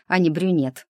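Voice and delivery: ordinary delivery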